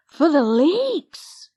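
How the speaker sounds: high, slow eager cry; excited cry. Acted. Lizzie thinks of Dad's leeks, a high, slow excited cry. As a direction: cry it out as a happy idea. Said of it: "cried Lizzie".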